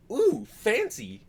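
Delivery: high, slow, hammed-up "Ooh, fancy"; mock impressed guest. Waiter mocking guests impressed by the accent, high and drawn out. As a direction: squeal it like a snooty guest. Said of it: "It makes them think".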